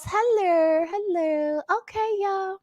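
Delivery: usual speaking voice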